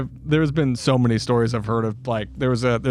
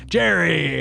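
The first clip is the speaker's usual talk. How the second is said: higher mock call of a name; 5 semitones higher than usual. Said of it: "be like".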